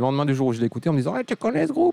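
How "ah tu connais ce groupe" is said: very high squeaky cartoon voice. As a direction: pitch way up like a cartoon. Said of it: "Il parle pas comme ça".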